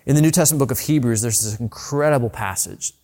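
ordinary delivery

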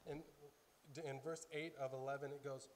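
ordinary talk, nothing marked

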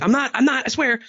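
high, panicky mock protest; imitating someone caught out, hammed-up high pleading; defensive denial. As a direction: squeak out a frantic denial. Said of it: "distancing yourself and be like".

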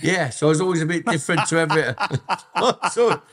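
laughing it off, quick and high. Real, not acted. Telling the interviewer he was always different, laughing fast and high.

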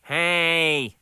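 a touch of alarm in the objection; frightened protest. A narrator's reading. Cook protests to customer, mild frightened protest. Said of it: "protested, suddenly frightened".

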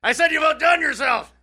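repeated high and loud for a deaf man; shouting. Acted. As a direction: yell it so he hears.

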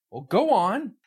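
high, loud impatient order; barking. Acted. Boss barking at his assistant to open the crate. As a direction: snap the command out sharply. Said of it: "he barked at Stefan".

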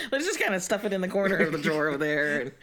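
ordinary talk

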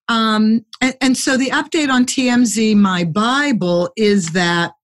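usual speaking voice

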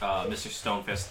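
usual speaking voice

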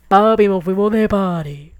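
mock party cheer. Telling her dad they're off partying, gleefully. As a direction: celebrate like you just got free.

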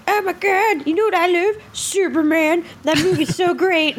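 high, gushing fangirl voice; mock a bubbly new fan. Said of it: "everybody's like".

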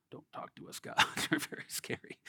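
whispered, scared plea; frightened whisper. Retold or imitated. Israelites at Sinai pleading with God, scared whisper. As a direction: whisper it like you're hiding from God. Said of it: "very scary God".

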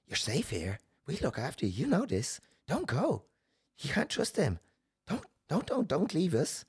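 high, swooping spirit voice; imitated clinging spirits. Dark spirits pulling someone back, high coaxing imitation. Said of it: "they were, like, clawing at me".

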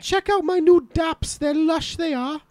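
Welsh accent. Shown as a demonstration. Giving Steve a Welsh slang sentence in a Welsh accent. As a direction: lilt it like a Welsh girl showing off her shoes. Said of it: "now I'll say in a sentence".

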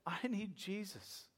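soft, slow retold confession; hushed plea. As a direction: confess it quietly and earnestly, as he did. Retells man's earnest confession.

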